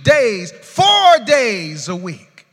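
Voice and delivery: loud prayed promise, slowing at the end; imitated prayer. Pastor voicing a believer's promise to God, shouted.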